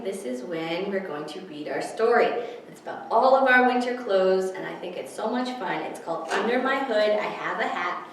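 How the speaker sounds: usual speaking voice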